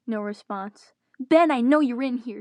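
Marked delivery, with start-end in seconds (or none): 1.2-2.4 loud call across the room, pitch a bit up; yelling. Acted. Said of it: "Toby yelled".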